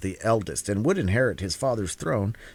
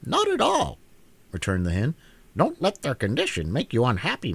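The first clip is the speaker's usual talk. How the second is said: high, brisk hen voice; 11 semitones higher than usual. Acted. Hen cheerfully promises to bring the children back. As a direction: cheerfully take charge. Billina reassures.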